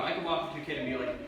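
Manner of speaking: ordinary delivery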